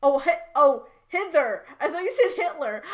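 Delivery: usual speaking voice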